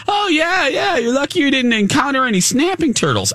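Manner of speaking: high, offhand put-on voice; lightly voiced zookeeper, unbothered; breezy zoo guy. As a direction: shrug it off lightly. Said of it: "he goes".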